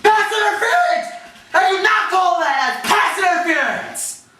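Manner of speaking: high-pitched outraged sideline yell; coach freaking out. Retold or imitated. Coach protesting a missed call, frantic shouting. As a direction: imitate a coach raging at the refs. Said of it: "they were freaking out the officiating".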